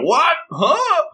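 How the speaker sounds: high-pitched mock astonishment; hammed-up startled questions; shocked, imitated. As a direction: yelp it in comic disbelief, pitch shooting up. Acted shock at unexpected dinner invitation.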